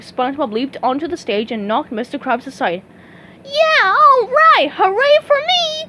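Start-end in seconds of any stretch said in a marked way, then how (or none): 3.4-5.9 high, loud, slow cheer; excited cry. Acted. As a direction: cheer for yourself, drawn out. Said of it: "cried SpongeBob".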